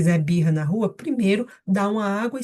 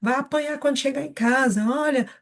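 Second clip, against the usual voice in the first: higher-pitched nagging warnings; bronca repetida; 5 semitones higher than usual. Retold or imitated. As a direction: imitate a parent's repeated, ineffective warnings. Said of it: "você falou várias vezes". (bronca repetida em tom agudo)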